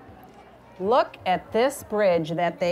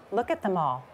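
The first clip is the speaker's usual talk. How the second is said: plain reading voice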